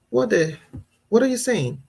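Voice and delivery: mock-scold a child in disbelief; indignant scolding. Mom pulling up her son for answering "what", indignant scolding.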